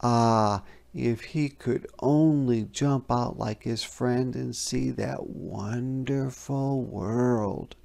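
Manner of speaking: ordinary delivery, nothing marked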